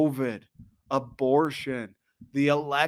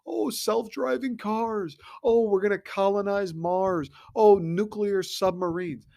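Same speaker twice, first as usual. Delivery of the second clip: mock awe; 6 semitones higher, slower than usual. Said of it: "we talk about how great the human race is".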